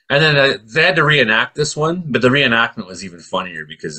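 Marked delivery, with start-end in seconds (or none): none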